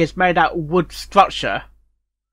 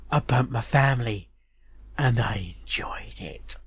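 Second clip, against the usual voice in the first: a hint of psychopath whisper, softer; creepy confession; 6 semitones lower, 7 dB quieter than usual. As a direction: confess it eerily, almost breathless. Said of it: "What do you do?".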